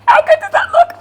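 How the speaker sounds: can't contain the delight; excited. Real, not acted. Proud reveal of baked loaves.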